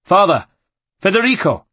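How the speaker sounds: loud, high calls into a dark house; shouting names. Acted. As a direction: yell for your family, alarmed. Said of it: "calling as he ran".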